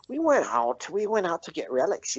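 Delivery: high, eager character voice; excited storytelling. Acted. Bullywug tells Sin about hunting relics, excited.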